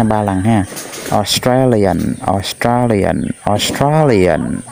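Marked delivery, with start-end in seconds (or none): 1.1-4.6 slow, stretched "Australian"; model pronunciation. Shown as a demonstration. Teacher reads Australian three times for pupils, slow model pronunciation. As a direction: say "Australian" slowly for learners to copy. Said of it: "chúng ta nghe thầy đọc ha".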